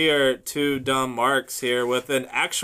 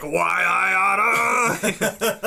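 mock angry shout; 6 semitones higher, much slower than usual. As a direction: shake a fist, cartoon style. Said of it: "Jake's like".

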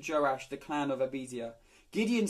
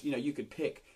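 ordinary talk

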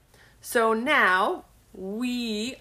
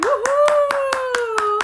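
excited cheer; 10 dB louder than usual. Real, not acted. Cheering with baby.

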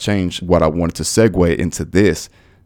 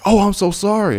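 high-pitched mock-apologetic clerk; high put-on apology; imitated apology; 11 semitones higher than usual. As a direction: mimic an over-sweet sorry, pitched way up. Mimics clerk's apology in story.

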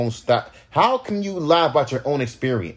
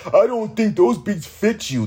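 higher, snooty mock critic voice; 7 semitones higher than usual. Rapper mimicking fans who say his beats don't fit him. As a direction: parrot the critic with disdain. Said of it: "they'll say some dumb shit like".

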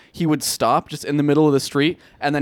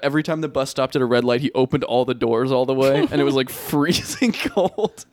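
ordinary delivery

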